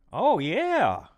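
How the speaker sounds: light up at the discovery; happy surprised exclamation; pleased surprise. Real, not acted. Discovers a plugin feature.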